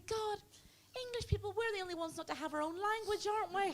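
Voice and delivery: very high, put-on lady voice; dismayed dentist lady. Dentist lady realising English lack a language, high dismayed voice. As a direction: lament it naively in a high voice. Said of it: "She said".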